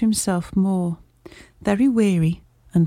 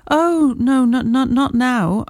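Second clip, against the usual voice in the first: higher, louder, halting protest; agitated stammer; 5 semitones higher, 4 dB louder, slower than usual. Acted. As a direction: stumble over the nots in alarm. Shaken patient refusing, stammering.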